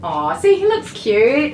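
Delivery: high, fond aww; high cooing. Real, not acted. Groomer cooing over the finished dog.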